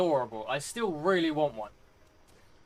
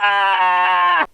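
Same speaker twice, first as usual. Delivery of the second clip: high, loud scream of frustration; frustrated scream; 12 semitones higher, 11 dB louder than usual. Real, not acted. Gamer losing progress, loud frustrated scream. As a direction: scream as it all goes wrong. Dying in game.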